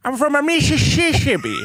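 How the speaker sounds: high put-on accent; mock Indian accent. Comic playing a scam caller, high put-on Indian accent. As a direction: stumble over Mississippi. Mock telemarketer role play.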